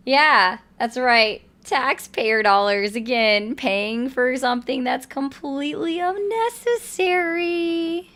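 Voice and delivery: loud, slow exasperated rant. Real, not acted. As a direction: vent it, drawn out. Streamer ranting after caller hung up.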